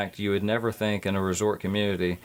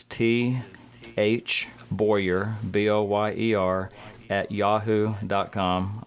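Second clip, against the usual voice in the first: measured dictation with gaps between the parts; deliberately slow; slower than usual. Real, not acted. Guest gives listeners his email to write down, slowly. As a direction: spell it out slowly, letter by letter. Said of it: "I'm gonna say this slow".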